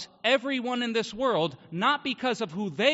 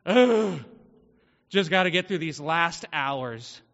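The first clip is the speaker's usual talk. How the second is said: a hint of a tired coworker, lower voice; weary coworker; 7 semitones lower, 4 dB louder, slower than usual. Telling a sermon crowd how Darren groaned about his shift, mildly tired.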